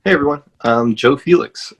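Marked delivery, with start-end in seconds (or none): none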